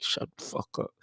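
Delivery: a hushed, breathy shut-up whisper; whispered retort. Retold or imitated. Fan annoyed by draft-night talk, whispering. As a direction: hiss it under your breath at chatter. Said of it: "I'll be like".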